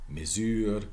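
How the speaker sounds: MESURE as "muh-ZÜR"; French pronunciation. Shown as a demonstration. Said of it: "Same in French".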